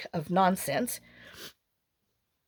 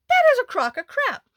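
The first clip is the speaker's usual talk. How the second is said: much higher, louder exasperated blurt; indignant outburst; 5 dB louder than usual. Retold or imitated. Retelling a jury duty call, a high exasperated outburst. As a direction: fling it out as the thing she only thought. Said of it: "Okay, I didn't say it, but boy, I'm thinking it".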